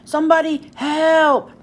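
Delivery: Pete's desperate call; yelling for help. Acted. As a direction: Pete stuck in the sticky web, calling for anyone. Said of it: "yells Pete".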